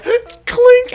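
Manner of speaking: high imitated camera clink; camera click. Imitating taking the picture, a high clink. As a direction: squeak the shutter sound. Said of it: "I'm like".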